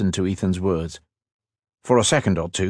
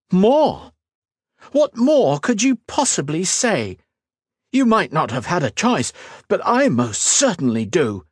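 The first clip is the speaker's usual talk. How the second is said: high-pitched scornful outrage; 10 semitones higher than usual. A narrator's reading. Woman rejects her fiancé's excuse, scornful. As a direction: throw it back with incredulous scorn. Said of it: "Her scornful laugh sounded loud".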